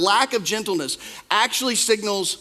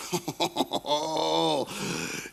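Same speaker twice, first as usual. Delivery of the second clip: soft laugh; 4 semitones lower, 8 dB quieter than usual. Real, not acted. Laughing at the joke.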